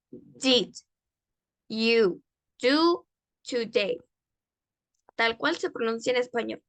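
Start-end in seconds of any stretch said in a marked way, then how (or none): 0.1-4.0 very slow, isolated words; pronunciation demo. Shown as a demonstration. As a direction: read it out word by word with long pauses. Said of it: "cómo se pronuncia en inglés".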